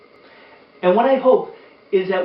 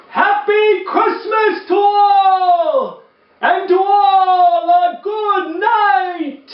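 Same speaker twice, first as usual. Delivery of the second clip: higher, louder, slow booming call; Santa exclaiming; 14 semitones higher, 8 dB louder, much slower than usual. Acted. Santa shouting farewell to everyone as he flies away, booming slow call. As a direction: call it out big like Santa riding off into the night. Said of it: "I heard him exclaim".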